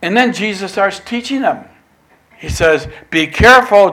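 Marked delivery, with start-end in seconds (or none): 3.1-3.9 loud, higher-pitched caution; warning. Retold or imitated. Jesus cautioning his disciples in the boat, loud warning. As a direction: call out a sharp warning. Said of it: "Jesus warned".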